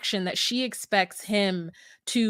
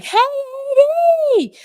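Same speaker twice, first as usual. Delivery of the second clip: high, slow, drawn-out call across the room; dramatic yell; 8 dB louder, much slower than usual. Retold or imitated. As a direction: holler her name across the room. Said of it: "I'm being dramatic".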